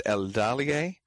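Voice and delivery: Eldalie stressed on DA; stress on second syllable. Shown as a demonstration. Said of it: "stress on the second syll".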